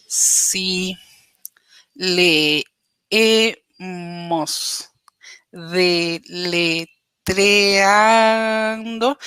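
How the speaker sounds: very slow, choppy, sounding out each syllable; halting, letter-by-letter reading. Shown as a demonstration. Teacher shows students what non-fluent reading sounds like, very slow and halting. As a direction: read it like a struggling beginner spelling the word out. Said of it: "Si yo leyera de esta manera".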